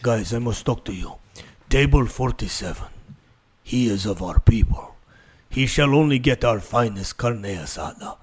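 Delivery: put-on low, solemn Mexican accent; mock Mexican waiter. Waiter briefing the kitchen in secret, mock-solemn low Mexican accent. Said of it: "No one is a waiter going to the back and be like".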